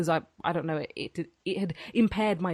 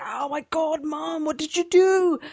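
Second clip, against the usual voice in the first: mock panicked cry; 10 semitones higher than usual. Retold panic quoting herself.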